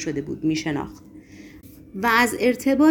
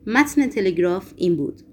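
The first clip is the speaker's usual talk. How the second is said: ordinary delivery, nothing marked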